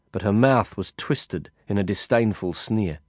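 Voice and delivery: ordinary delivery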